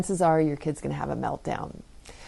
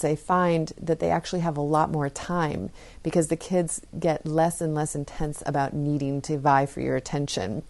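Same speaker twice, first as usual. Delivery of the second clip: ordinary talk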